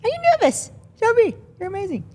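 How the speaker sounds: high teasing reassurance; playful high voice. Acted. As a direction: reassure her in a squeaky playful voice.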